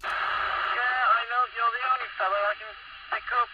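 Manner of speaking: ordinary delivery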